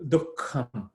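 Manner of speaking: "Dukkham" with a firm k; emphasized. Shown as a demonstration. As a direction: press the k in "Dukkham". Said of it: "a little bit more emphasis".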